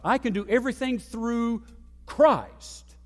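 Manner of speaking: "Christ" set off by a pause, loud and high; stress on CHRIST. Shown as a demonstration. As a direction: punch the word "Christ". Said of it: "emphasize a different word".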